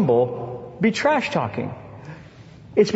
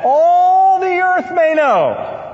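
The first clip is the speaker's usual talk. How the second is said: drawn out, higher and louder; preaching climax; 11 semitones higher, 5 dB louder, much slower than usual. Acted. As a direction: stretch the words out to the whole earth like a preacher. Sermon climax quoting David.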